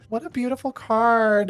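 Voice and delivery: usual speaking voice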